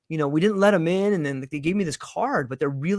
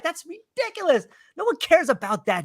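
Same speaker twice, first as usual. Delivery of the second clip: high-pitched acted scoff at your own worry; dismissive self-talk; 12 semitones higher than usual. Dismisses own silly worries, acted.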